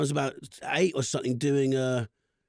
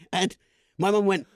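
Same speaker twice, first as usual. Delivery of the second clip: ordinary delivery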